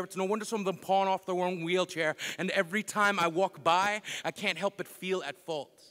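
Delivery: usual speaking voice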